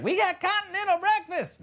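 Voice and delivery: high-pitched mock motel boast; excited motel staff. Motel staff touting breakfast to guests, high mock boast. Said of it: "They're all like".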